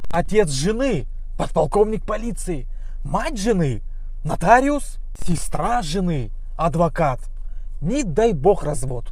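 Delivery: each family member and job spelled out slowly and loudly; slow loud listing. Acted. Comic telling a crowd about a lawyer family, slow and loud. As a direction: lay out the list slowly, weighty and loud.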